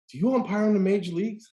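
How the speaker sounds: lightly voiced eager kid; a hint of an excited little boy; imitating a kid.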